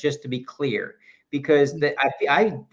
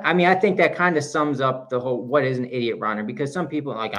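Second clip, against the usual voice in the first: ordinary delivery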